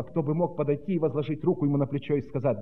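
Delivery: ordinary delivery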